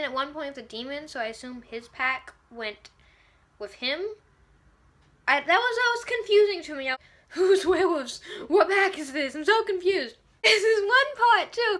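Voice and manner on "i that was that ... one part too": high, loud, fast and laughing in disbelief; cracking up at how confusing it was; half-laughing, half-wailing confusion. Real, not acted. Baffled reaction to the episode.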